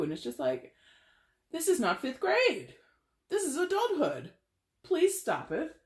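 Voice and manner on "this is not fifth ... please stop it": high-pitched, exasperated plea; exasperated scolding. Acted. Reader telling lovesick viewers to grow up, exasperated. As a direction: scold them, pitched way up and drawn out.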